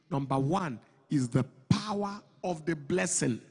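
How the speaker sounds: normal talking voice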